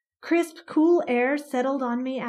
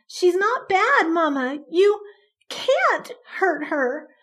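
pleading; 6 semitones higher, 5 dB louder, slower than usual. Acted. Child pleading with mother.

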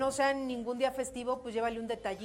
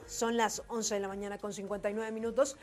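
upbeat louder host read; radio announcer; 5 dB louder than usual. Acted. Radio host announcing the break and return, upbeat. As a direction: sell the break and the comeback.

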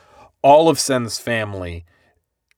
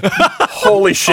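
high laughing "holy shit"; laughing disbelief; 4 dB louder, much slower than usual. Real, not acted. Laughing reaction to dub line.

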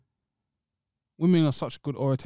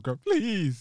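hammed-up begging, a little higher; mock pleading; 4 semitones higher than usual. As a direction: plead as if fallen to your knees. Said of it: "What if they fall to their knees?".

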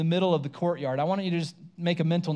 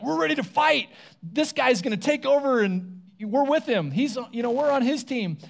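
high, eager rally cry of the disciples; fired-up disciples ready to fight; eager fighters; 7 semitones higher than usual. Retold or imitated. Disciples rallying behind Jesus, high and eager.

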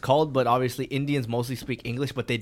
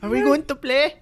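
high mock Arabic kid voice; Arabic accent; 10 semitones higher than usual. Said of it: "an Arabic voice".

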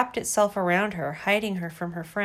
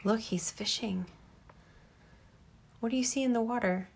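whisper; 3 semitones higher, 6 dB quieter than usual. Real, not acted. Parent whispers to child mid-story.

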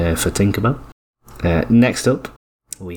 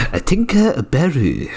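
exaggerated Japanese accent, pitched up; 6 semitones higher than usual. Shown as a demonstration. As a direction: say Tinker Beru like Japanese. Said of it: "It's how it's meant to be said".

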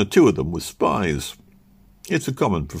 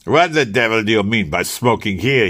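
mild raised rebuke; angry cry; 3 semitones higher, 5 dB louder than usual. Acted. Manager confronts the smoking waiter, fuming. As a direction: snap at him, fuming. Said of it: "he cried".

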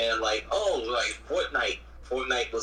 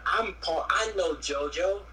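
higher-pitched mock boasting, stretched out; mock name-dropper; 5 semitones higher than usual. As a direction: put on an eager "I know people" act.

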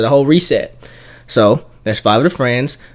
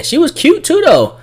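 high gushing; 4 dB louder than usual. Real, not acted. Gushing about girl.

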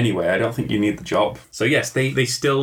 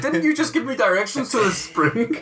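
high-pitched, incredulous accusation; high, put-on questioning voice; mock indignant hero; 13 semitones higher than usual. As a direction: challenge the witch in mock disbelief. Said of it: "And he's like".

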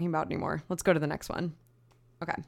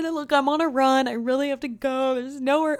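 high, urgent begging; desperate pleading; 8 semitones higher, 4 dB louder than usual. Retold or imitated. Runner pleading with the clerk for the bathroom, high and desperate. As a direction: plead as if you can't hold it. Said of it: "I said".

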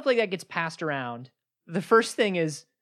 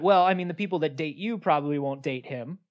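normal talking voice

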